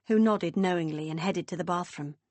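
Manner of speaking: ordinary delivery, nothing marked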